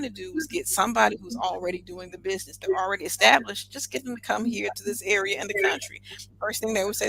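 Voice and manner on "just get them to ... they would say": normal talking voice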